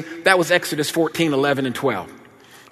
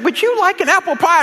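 very high imitation of a gracious hostess; polite mama; 14 semitones higher than usual. Mother offering pie to the neighbor, high polite voice. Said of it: "Our polite mama".

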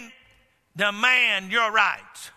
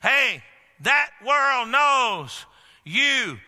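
full-force preaching; 3 semitones higher, slower than usual. Acted. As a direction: build it into a fiery cry.

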